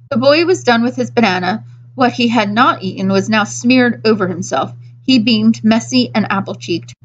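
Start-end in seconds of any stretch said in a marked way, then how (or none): none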